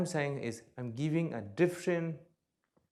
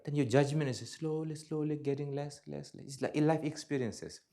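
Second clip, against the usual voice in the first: ordinary talk, nothing marked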